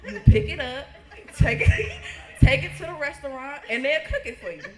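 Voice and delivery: loud laughing through the quote. Retold or imitated. Telling the family about the roadkill restaurant, laughing loudly. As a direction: crack up while telling it.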